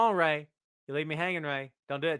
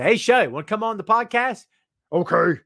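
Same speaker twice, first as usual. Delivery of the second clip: a lazy stab at a giant's voice; terrible Big Show impression; 5 semitones higher, 8 dB louder than usual. Host mocking himself with a bad Big Show voice. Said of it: "That was a terrible Big Show impression".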